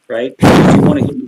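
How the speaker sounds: usual speaking voice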